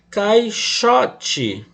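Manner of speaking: teacher's demo of "Caixote", marking "xo" as strong; stress on penultimate syllable. Shown as a demonstration. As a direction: lean hard on the middle syllable of "Caixote". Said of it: "Sílaba tônica na penúltima".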